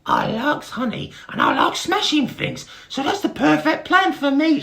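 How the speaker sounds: very high, loud squeaky beetle voice; high squeaky Bart. Acted. Dim little brother greeting Barry, high squeaky voice.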